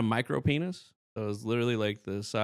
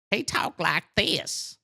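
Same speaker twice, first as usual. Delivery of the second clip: high, slow, put-on cartoon kid voice; Bobby Hill; 9 semitones higher, much slower than usual. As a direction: impersonate a cartoon boy, high and drawn out. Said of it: "sounding like Bobby Hill".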